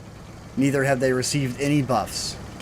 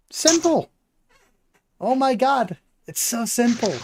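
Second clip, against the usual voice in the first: incredulous excitement; 10 semitones higher than usual. Real, not acted. Praising how simple old tree was.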